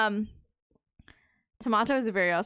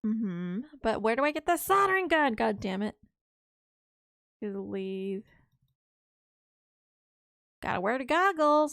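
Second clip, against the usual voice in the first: normal talking voice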